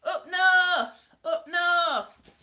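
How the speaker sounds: loud, high mock Northern English accent; attempted Northern accent. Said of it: "I have not mastered any of the accents".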